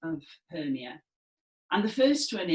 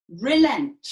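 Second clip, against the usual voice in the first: relent, much louder and higher; forceful single word; 4 semitones higher, 10 dB louder than usual. Shown as a demonstration. Teacher leading Demetrius's one-word gesture, forceful. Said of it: "relent with our gesture".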